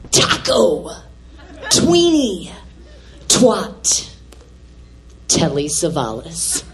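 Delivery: punchy single words, sinking very low by the end; mock daughter's monologue. Scandalised mother quoting daughter's list of words, punchy mock recital. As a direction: imitate a stage recital, word by word. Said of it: "going on and on about her".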